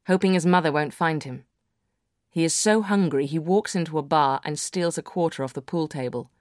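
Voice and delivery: plain reading voice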